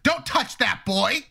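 slow, high, prim warning; mock a meddling lady telling him off; bossy stranger. Stranger in the store warning the father, slow and prim. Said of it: "He's like".

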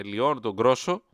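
ordinary talk

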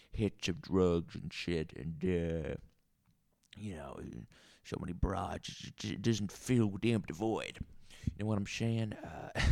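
put-on drunk Southern barfly musing; lazy put-on Southern voice. Bar character rambling monologue.

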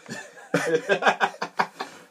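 high burst of laughter; loud laugh. Real, not acted. Laughing at his co-host's joke, loud high laugh. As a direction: crack up loudly.

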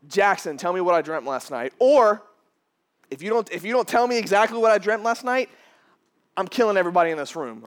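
loud, pushy mock threat from a king; angry king. King threatening Jackson and the room, loud and mock-menacing. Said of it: "let's pretend for a second".

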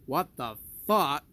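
loud, slow mock exclamation of shock; shocked boss. Boss seeing the tiny paycheck, loud mock shock. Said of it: "your boss obviously saw the paycheck and was like".

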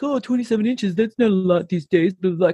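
brushing off the snow total; dismissive scoff. Retold or imitated. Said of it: "a lot of people are like".

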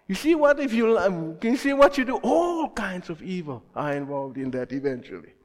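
ordinary delivery

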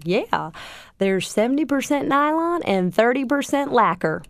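imitating Ted's thick Southern accent, lightly. Ted on the phone listing the fabric, in a Southern accent. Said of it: "the most thick Southern accent".

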